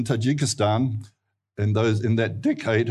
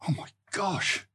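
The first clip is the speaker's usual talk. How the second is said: soft, higher-pitched retold gasp of concern; hushed dismay; 8 semitones higher, 7 dB quieter than usual. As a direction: think it aloud under the breath, appalled. Retold shocked thought on hearing it.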